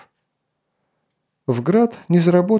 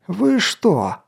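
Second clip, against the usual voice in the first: higher bellowing captain; roaring; 7 semitones higher than usual. Acted. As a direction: roar it out in outrage. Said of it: "заревел он".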